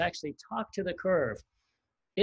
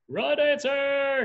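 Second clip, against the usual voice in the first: high, loud, drawn-out call; sustained demonstration shout; yelled broadcast; 12 semitones higher, 6 dB louder, much slower than usual. Shown as a demonstration. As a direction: broadcast it in a stretched high yell. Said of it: "You're gonna yell it out".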